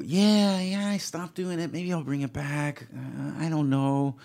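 lightly doing Jerry, unbothered and noncommittal; Jerry Seinfeld. Retold or imitated. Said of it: "And he'd go".

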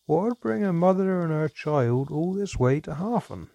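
a sly innkeeper dwarf fishing for answers; probing dwarf. A narrator's reading. Dwarf questions mother.

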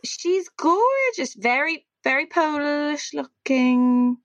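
drawn out, very slow words. Real, not acted. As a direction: stretch each word while distracted. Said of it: "my voice slowed down there 'cause I was zooming".

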